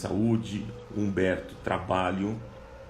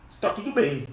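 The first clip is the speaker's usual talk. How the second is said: lightly voiced woman, higher; woman's answer; 11 semitones higher, 4 dB louder than usual. Preacher voicing the woman answering Geazi, lightly voiced. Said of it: "a mulher respondeu a Geazi".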